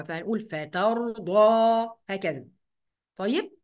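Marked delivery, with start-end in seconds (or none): 0.5-1.9 "فترضى" with thick d and dark final a; heavy daad and alif. Shown as a demonstration. Teacher summing up for students, "فترضى" with emphatic ending. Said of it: "يبقى الألف اللي وراها كمان مفخمة".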